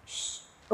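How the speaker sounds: soft drawn-out hush; hushing hiss; shushing. Acted. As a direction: quietly shush an angry man. Wife hushes the shouting patient.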